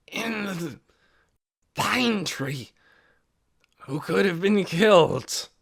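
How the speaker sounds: put-on slow, labored old voice; weak old man. A narrator's reading. Sick old Ben struggling to address the meeting, slow and strained. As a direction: force each word out through weakness.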